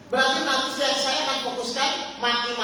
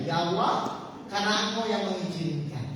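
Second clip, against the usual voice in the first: quiet inner prayer; 5 semitones lower, 5 dB quieter than usual. Shown as a demonstration. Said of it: "geser pikirannya".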